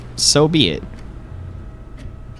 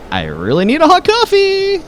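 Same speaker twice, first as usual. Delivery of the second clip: high, excited character line; mock chirpy plea for coffee; voicing the character. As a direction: squeal it like a cold hiker.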